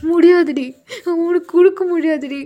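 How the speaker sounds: tearful shouting back and forth; half sob, half yell over the dress; two sisters crying and yelling at each other; crying quarrel. Acted. Both girls crying while fighting.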